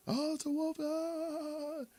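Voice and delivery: put-on high, panicky falsetto boy; boy crying wolf. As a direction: shriek it up high like a scared kid. Boy crying wolf third time.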